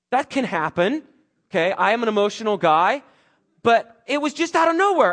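fighting back tears while pushing through; getting emotional. Real, not acted. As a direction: voice rising high and loud with real emotion. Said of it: "even right now as I'm getting emotional".

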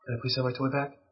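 soft practice request, slightly patronizing; a little condescending. Shown as a demonstration. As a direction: ask very softly with a touch of condescension. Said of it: "that was a little bit condescending".

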